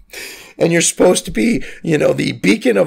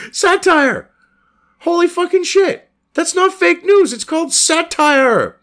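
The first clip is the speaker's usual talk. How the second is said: higher, emphatic outburst on satire; high-pitched indignant rant; outraged exclamation; 11 semitones higher than usual. Real, not acted. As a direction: explode in indignation. Ranting at fact-checking.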